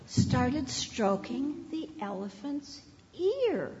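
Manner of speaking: put-on expressive read-aloud for kids; storybook reading. A narrator's reading. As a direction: read it like story time on the rug. Reads a story to children.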